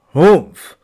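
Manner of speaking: loud dismissive grunt; grumpy humph. Acted. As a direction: huff it out like a sulky camel. Camel refuses the ox.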